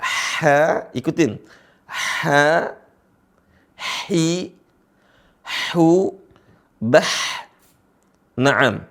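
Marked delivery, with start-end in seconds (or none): 0.0-0.4 "H" as a long, airy throat sound; soft, sustained Arabic haa on "H"; pronunciation demo. Shown as a demonstration. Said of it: "cara bacanya H bagaimana?". 1.9-7.1 "H, hi, hu, bah" each opened with airy throat friction; separated syllables, the h in "H, hi, hu, bah" drawn out; pronunciation drill. Shown as a demonstration. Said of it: "cara bacanya H bagaimana?".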